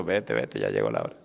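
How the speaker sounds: a hint of a strict mother ending the visit; mother shooing the suitor. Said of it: "la doña le pasaba por enfrente diciendo". (la madre de la novia despide al novio, en voz más baja)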